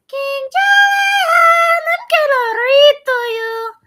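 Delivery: very high, squeaky cartoon voice; Mort from Madagascar. Streamer reading history notes to viewers as Mort, high cartoon voice. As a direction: read it loud and high like a tiny excitable creature. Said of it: "as Mort from Madagascar".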